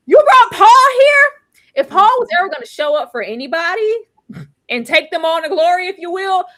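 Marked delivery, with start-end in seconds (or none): 0.1-1.7 much higher, louder, slowed-down indignant cry; outraged disbelief. Real, not acted. Fan confronting the writers, outraged and slow. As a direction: stretch it in total disbelief.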